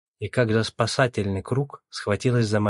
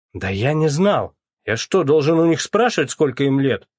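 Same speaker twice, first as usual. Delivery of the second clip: raised, louder defensive retort; snapping back; 5 semitones higher, 7 dB louder than usual. A narrator's reading. Young suspect defending himself to the captain, snappish. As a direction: snap back in indignant protest. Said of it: "огрызнулся парень".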